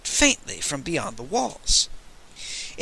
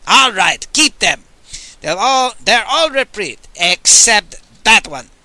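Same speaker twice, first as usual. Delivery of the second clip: Pope voice; 6 semitones higher, 6 dB louder than usual. Acted. Pope sparing prisoners, pointing.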